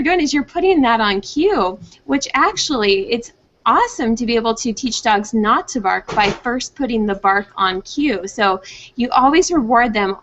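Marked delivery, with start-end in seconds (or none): none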